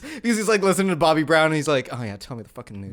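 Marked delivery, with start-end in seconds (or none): 1.9-2.9 sprawled on the couch, barely bothering; lazy low Robin. Retold or imitated. Robin voiced, lazy at home.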